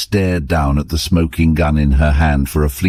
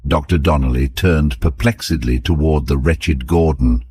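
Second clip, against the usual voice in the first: usual speaking voice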